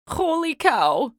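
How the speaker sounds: loud slow holler. A narrator's reading. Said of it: "hollered Manny the cook".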